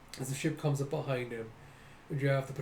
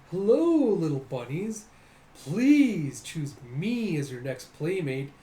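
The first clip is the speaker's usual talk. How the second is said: gushing mock greeting; 3 semitones higher, 6 dB louder, slower than usual. Character begging bunnies to be playmates, hammed-up gushing. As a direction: coo and plead in a put-on voice.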